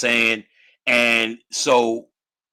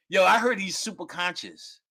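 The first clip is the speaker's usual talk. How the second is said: usual speaking voice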